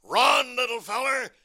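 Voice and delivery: high-pitched urgent bulldog call; cartoon bulldog urging his little friend on; Chopper. Bulldog tells the chased duck to run, urgent character voice. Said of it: "Chopper tells him to run".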